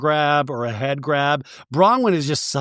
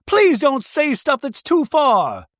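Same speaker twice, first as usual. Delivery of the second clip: much higher, fragile put-on voice; Bronwyn voice; 10 semitones higher, slower than usual. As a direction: play her as close to tears. Bronwyn pleading.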